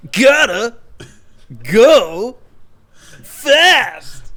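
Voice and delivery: loud, high, one word at a time; halting bursts with long gaps between words; botched layered Sonic impression. Nested impression for friends, loud and halting. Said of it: "impression of Spencer doing an impression of Teddy".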